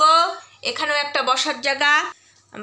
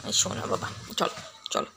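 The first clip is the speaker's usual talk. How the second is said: soft coaxing whisper to a pet; whispering to the dog to settle him; 10 dB quieter than usual. Real, not acted. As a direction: hush him gently toward bed.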